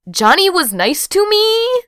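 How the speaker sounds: very high, drawn-out incredulous question; hammed-up high-pitched astonishment; overwrought questioning. A narrator's reading. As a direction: squeal it slowly in disbelief. Frantic text to sisters, acted.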